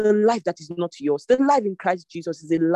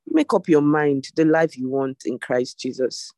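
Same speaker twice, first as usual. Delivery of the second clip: normal talking voice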